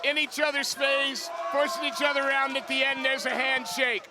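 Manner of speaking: loud, high final-bell call; excited commentary. Real, not acted. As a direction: call the last seconds with energy.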